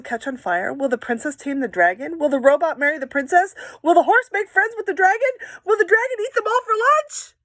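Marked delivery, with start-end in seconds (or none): 2.2-7.3 questions rising higher and louder, faster; building excitement. Acted. Teacher asking kids prediction questions, building excitement. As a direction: pile up the questions with mounting glee.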